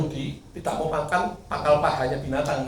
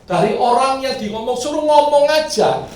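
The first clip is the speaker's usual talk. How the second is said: usual speaking voice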